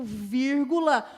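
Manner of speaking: "vírgula" slow and loud; stressed comma call. Shown as a demonstration. Teacher calls out "vírgula" slow and loud. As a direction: hit "vírgula", slow and firm. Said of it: "oração subordinada adverbial temporal".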